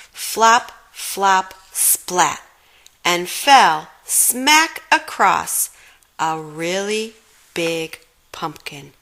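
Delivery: ordinary delivery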